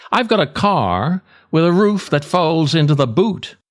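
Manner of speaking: ordinary talk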